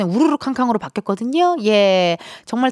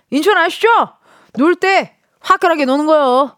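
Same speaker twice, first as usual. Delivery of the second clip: hammed-up brash Incheon voice, higher and louder, leaning on 때; full Incheon vibe; 7 semitones higher, 4 dB louder than usual. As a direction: go all-out with the Incheon bravado. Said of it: "완전 인천 바이브입니다".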